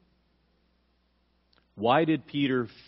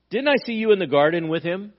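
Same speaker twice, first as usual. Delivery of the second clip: higher, louder pointed question; loud, raised-pitch accusation; accusing challenge; 7 semitones higher, 7 dB louder, faster than usual. Retold or imitated. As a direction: challenge him as a suspicious witness. Acting the slave accusing Peter.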